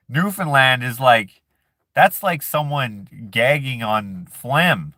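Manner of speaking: ordinary talk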